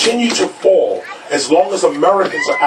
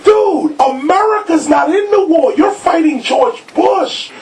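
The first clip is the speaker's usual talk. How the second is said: retold animated pitch; 9 semitones higher than usual. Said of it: "I'm like".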